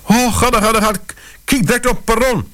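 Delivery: high, breathless fussy woman's voice; gulping panicky lady. Acted. Lady urges the narrator onto the platform, gulping in high panic. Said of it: "gulpte ze tegen mij".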